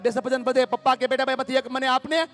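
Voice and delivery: high, loud storytelling to a crowd; fiery preaching. Real, not acted. Preacher telling the hall about a father, booming. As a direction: build the story up to a big punch.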